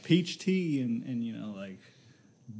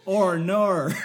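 Australian vowels, higher and louder, with a laugh; mock Australian accent; 5 semitones higher, 7 dB louder than usual. Co-host teasing about the Australian accent, mock Australian accent. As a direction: put on an exaggerated Australian "oh no". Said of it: "the Gen Zs are always saying".

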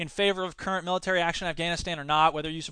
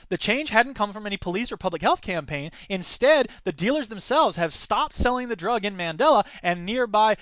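ordinary talk, nothing marked

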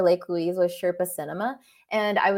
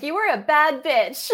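higher, louder hype-up to yourself; mirror pep talk; 7 semitones higher, 5 dB louder than usual. Pretend. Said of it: "I have mirror pep talks with myself".

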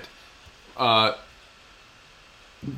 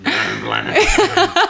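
laughing; 5 dB louder, much slower than usual. Real, not acted. Mock zombie name, laughing.

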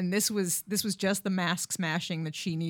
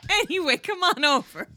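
eager creep; 8 semitones higher, 5 dB louder than usual. Retold or imitated. Mock lover voice.

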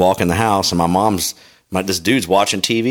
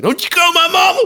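put-on kid's yell. A little kid yelling at a catcalling construction worker. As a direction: yell it like a small boy defending his mom. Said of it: "I was yelling shit".

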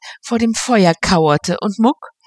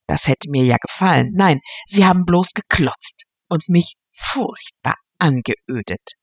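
ordinary delivery

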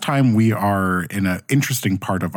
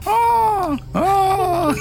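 mock scream. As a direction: yelp like a burning villager. Imitating the screams of townsfolk.

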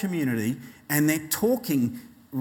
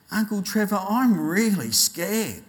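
put-on slower, higher, mildly frightened child; scared child; 5 semitones higher than usual. Boy wakes uncle at night, mildly scared and plaintive. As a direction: imitate a small boy admitting fear.

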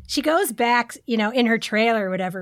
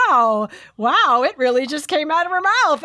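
louder, gawking wow; amazed commentator; 4 semitones higher, 5 dB louder than usual. Retold or imitated. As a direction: marvel at it out loud. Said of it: "the corn man was like".

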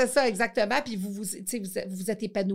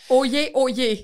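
loud, proclaiming oyez; town crier; 3 semitones higher, 8 dB louder than usual. Pretend. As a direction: call it out like a herald.

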